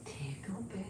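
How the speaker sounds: hushed, teasing the word; whispered name. Real, not acted. Owner whispering the toy name to tempt Nikki. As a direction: whisper it to the dog.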